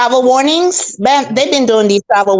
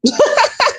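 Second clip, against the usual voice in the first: high burst of laughter. Real, not acted. Guest laughing at the host's joke about the Lord. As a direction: laugh off the question.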